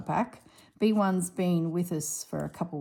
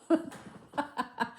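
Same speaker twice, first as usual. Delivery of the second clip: breathy giggle; 9 semitones higher than usual. Real, not acted. Laughing at brother's quip.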